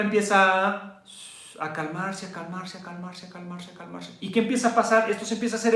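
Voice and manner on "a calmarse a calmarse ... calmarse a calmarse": each repeat softer, down to a hush; calm it down with the voice; fading to calm. Shown as a demonstration. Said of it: "muestra cómo se calma". (cada vez más suave)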